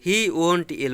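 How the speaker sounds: "he won't ill" said slowly and evenly; slow example. Shown as a demonstration. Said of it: "Did you get my point?". ("he won't ill" धीरे-धीरे)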